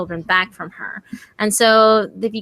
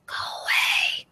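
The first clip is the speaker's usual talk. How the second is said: scratchy ghost woman; 6 dB quieter than usual. Retold or imitated. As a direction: rasp it without voice, like a ghost. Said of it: "in this really kind of scratchy tone".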